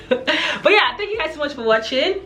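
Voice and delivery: high-pitched, giggly thanks; laughing. Real, not acted. Vlogger thanking viewers at the end, laughing. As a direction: thank them through a real laugh.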